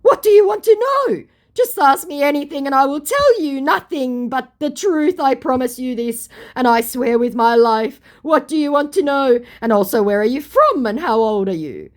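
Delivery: high, rushed, pleading scammer voice; mock him begging to be believed; hasty scammer. Vlogger mimics scammer hastily pleading, high and loud. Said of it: "he hastily puts in".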